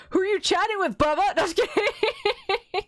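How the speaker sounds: high-pitched teasing that breaks into laughter; pry in jest, then giggle through it; playful teasing. Real, not acted.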